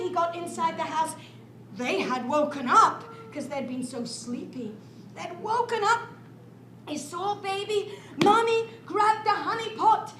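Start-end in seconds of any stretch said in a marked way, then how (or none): none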